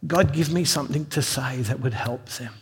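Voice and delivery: softly murmured plea to God; under his breath. Retold or imitated. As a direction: pray quietly, just under your breath. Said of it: "saying it just under my breath".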